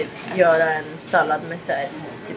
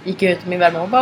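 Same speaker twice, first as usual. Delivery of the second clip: usual speaking voice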